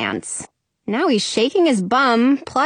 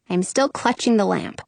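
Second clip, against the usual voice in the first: usual speaking voice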